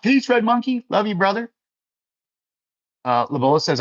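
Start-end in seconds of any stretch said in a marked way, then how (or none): 0.0-1.5 sing-song high farewell, much higher than usual; excited goodbye. Real, not acted. Streamer waving off a viewer who leaves, in a high, happy farewell. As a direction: send him off with a bright, lifted cheer.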